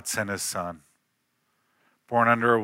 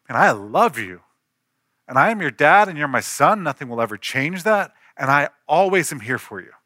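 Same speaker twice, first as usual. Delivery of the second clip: louder, higher impassioned promise; fervent declaration; 3 semitones higher, 5 dB louder than usual. Retold or imitated. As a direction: pour your heart into it. Father declares love to son.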